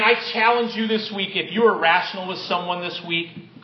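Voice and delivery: ordinary delivery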